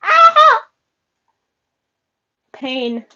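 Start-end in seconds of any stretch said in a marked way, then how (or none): 0.0-0.6 sudden high loud yelp; pained yelp. Real, not acted. Yelps in pain as the light comes on.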